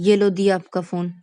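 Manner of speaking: put-on loud annoyed girl; angry. A narrator's reading. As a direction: slam the words down with the phone. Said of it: "गुस्से में phone को रख देती है".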